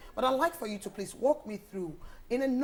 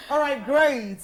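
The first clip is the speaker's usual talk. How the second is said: loud laughing question; 5 semitones higher, 10 dB louder than usual. Real, not acted. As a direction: laugh through the words. Host laughing with guest.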